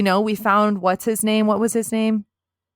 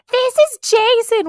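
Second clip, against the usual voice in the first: high, loud gushing squeal; Lauren introducing Jason; 9 dB louder than usual. Retold or imitated. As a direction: show him off, drawn out and way up high. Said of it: "and she's like".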